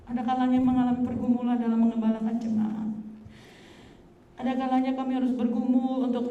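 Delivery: loud, earnest sermon delivery; fervent preaching. Acted. Pastor confiding ministry struggles to the congregation, loud and earnest. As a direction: press the point home to the church.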